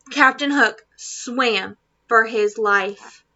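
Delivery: usual speaking voice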